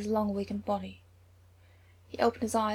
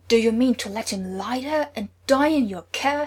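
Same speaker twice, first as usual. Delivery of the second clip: roaring, much louder angry accusation; 9 dB louder than usual. A narrator's reading. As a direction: roar it down the hall at the healer. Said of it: "he roared".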